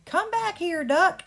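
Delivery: high, loud call; girl chasing a duck across the stage, calling; calling after the duck. Shown as a demonstration. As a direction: shout after a runaway duck. Demo of Marissa chasing duck.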